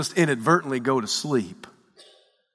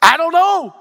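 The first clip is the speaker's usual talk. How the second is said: high, loud panicked-crew yell; raised voice, pitched way up; shouted reply; 13 semitones higher, 8 dB louder than usual. Acted. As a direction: yell it back over a raging storm. Disciples' frantic reply in storm.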